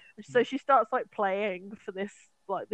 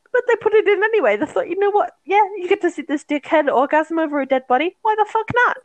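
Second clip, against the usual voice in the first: loud outraged rant; 9 semitones higher, 11 dB louder than usual. Real, not acted. Ranting about gross scene.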